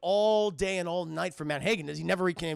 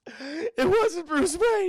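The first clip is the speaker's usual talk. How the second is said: very high, loud, drawn-out wail; desperate wail; 10 semitones higher, 6 dB louder, much slower than usual. Retold or imitated. Man recanting to Batman, desperate wail. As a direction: wail the denial slowly in panic.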